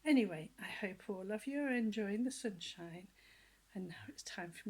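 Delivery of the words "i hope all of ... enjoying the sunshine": plain reading voice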